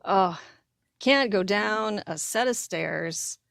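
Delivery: ordinary delivery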